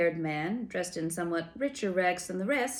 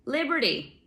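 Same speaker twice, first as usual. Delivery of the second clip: high, thin, pointed correction; sentry corrects the giant's password, thin and high; thin voice correcting; 7 semitones higher, 4 dB louder than usual. A narrator's reading. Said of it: "the thin voice corrected".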